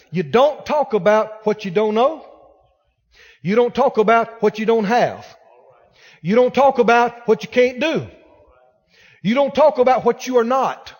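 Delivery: loud, hammering preacher cadence; rising sermon fervor; preaching at full force. Acted. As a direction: pound each line harder from the pulpit.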